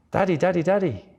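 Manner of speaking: put-on high excited child's voice; a kid squealing for daddy; child calling daddy. Child greeting returning father, high and excited.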